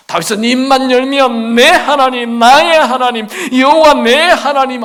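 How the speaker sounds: loud high repeated calls; full-force preaching. Real, not acted. Preacher to congregation, loud and high in fervor. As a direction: drive the names home, ringing.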